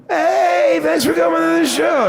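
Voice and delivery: high, sugary mock-friendly greeting; high falsetto customer-service voice; fake-nice jerk voice. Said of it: "The fucking jerk off I sound like".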